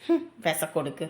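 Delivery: muttered insult. Acted. Muttered insult about the friend.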